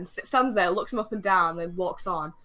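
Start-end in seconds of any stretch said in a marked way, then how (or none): none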